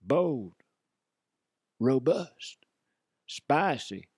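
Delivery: slow, drawn-out parody ad read; mock commercial announcer. Host advertising Turds of Desire by [name], mock announcer. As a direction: pitch it like a spoof product commercial, with long pauses.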